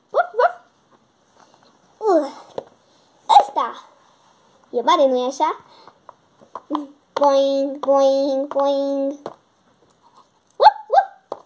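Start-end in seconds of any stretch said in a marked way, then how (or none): 0.1-0.5 high yappy woof; puppy bark. Acted. Puppy barks. 10.6-11.4 high loud yappy woof; puppy bark. Acted. The new puppy answers its owner with high yappy barks.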